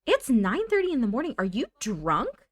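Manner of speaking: mock yell, starting high and loud; acted yelling. Nancy yelling at her drinking ex, indignant. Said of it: "She's gonna yell at him".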